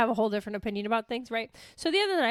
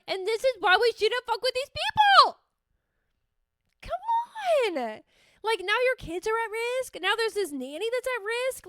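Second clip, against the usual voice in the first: much higher and louder, exasperated; alarmed outburst; 11 semitones higher, 4 dB louder than usual. Retold or imitated. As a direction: sound alarmed and exasperated at the risk. Retelling her own alarmed reaction.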